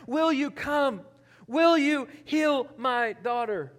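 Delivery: desperate mother's bold appeal, acted; bold proclamation. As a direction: proclaim it slowly and boldly, pleading. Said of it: "she's bold, and she goes and she proclaims".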